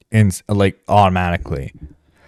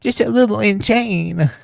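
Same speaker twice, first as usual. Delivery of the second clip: much higher, halting mock stutter; mock anime robot; 9 semitones higher than usual. Parodying the interviewer, high stammering robot voice. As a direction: stammer like a flustered anime bot. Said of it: "I find this a little bit insane".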